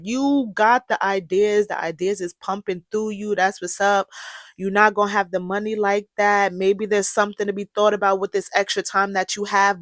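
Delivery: ordinary talk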